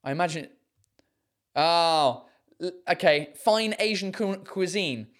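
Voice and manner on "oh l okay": ordinary talk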